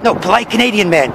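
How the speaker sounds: soft, gravelly superhero parody; Polite Canadian Man. Acted. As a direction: insist in a hushed Batman-style growl. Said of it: "keep your voice low and gravelly".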